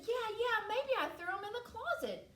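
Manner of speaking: put-on high, eager child voice; sudden girlish excitement; hopeful little girl. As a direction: jump on the idea, pitched high. Girl seizes on brother's idea.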